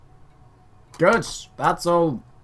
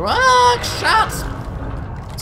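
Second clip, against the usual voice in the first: sudden shriek far higher, louder; startled yelp; 6 dB louder than usual. Real, not acted. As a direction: caught off guard by the crash, cry out. Crashes ship mid-sentence.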